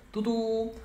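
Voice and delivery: second "tu" high and loud; short "tu", then a long high "tu"; bus horn imitation. Shown as a demonstration. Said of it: "Então, fica". (buzina de ônibus, segundo "tu" alto)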